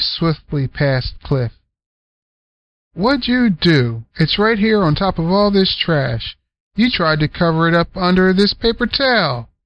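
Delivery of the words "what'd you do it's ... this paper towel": scolding aunt. Acted. Selma scolding.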